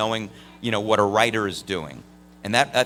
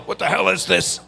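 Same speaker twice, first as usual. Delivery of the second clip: angry father; 7 semitones higher, 4 dB louder than usual. Retold or imitated. Father angry about phone bill.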